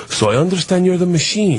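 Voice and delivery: put-on Russian accent, deliberate and official; Russian cop. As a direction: imitate a Russian policeman sizing someone up. Russian cop confronts him in story.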